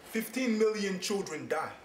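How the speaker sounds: impassioned slam, building in force; spoken word poem. Acted. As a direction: start measured, then drive the rhythm harder and harder.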